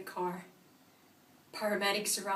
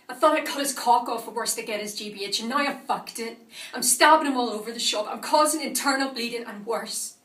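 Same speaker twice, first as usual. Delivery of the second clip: loud, driving, agitated confession; frantic anger; 7 dB louder than usual. Acted. Attacker realising the stabbing went too far, frantic anger. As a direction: let it tumble out loud and heated. Acted frenzy, plan gone wrong.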